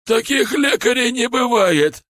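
high, loud, strained protest; outraged cry. A narrator's reading. Шар protests to the strange healer, outraged cry. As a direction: cry out in pained disbelief.

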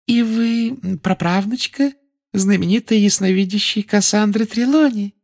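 high, sweet prim official; sickly sweet inquisitor; sugary high Umbridge voice. A narrator's reading.

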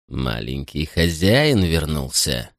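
slow, low, sinister drawl; low and slow, quietly threatening; menacing butler. Acted. Drunk butler greets boy menacingly.

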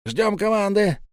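high-pitched command to hold; urgent commander's call; shouted order. A narrator's reading. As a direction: hold the squad back with a call.

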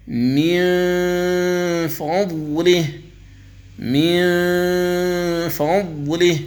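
ينظرون drawn out with the nasal hum held; slow tajweed recitation. Shown as a demonstration. Teacher demonstrating ikhfa before ظ, slow recitation. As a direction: chant ينظرون slowly through the nose. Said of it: "في قوله تعالى".

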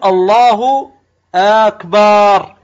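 "أكبر" said slow and loud as "akbaar", long aa after the b; lengthened ba. Shown as a demonstration. Teacher showing students the third takbir mistake, lengthened ba. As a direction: stretch the last syllable of "أكبر" into a long "baar". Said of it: "وكأنك تمد الباء".

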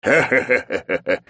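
sudden laugh, clutching a sore chest; laughing through pain. Acted. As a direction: laugh hard though it hurts. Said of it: "резко засмеялся мужик, схватившись за грудь".

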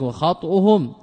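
وخطئهم with an "a" after the kha, "khat'uhum"; fatha on the kha. Shown as a demonstration. Teacher showing students the preferred vowelling of وخطئهم, "khat'uhum" with fatha. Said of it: "بالفتح".

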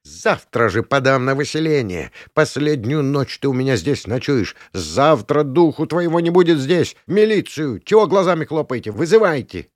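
indignant warden demanding action; ranting warden. A narrator's reading. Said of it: "изрыгала проклятия".

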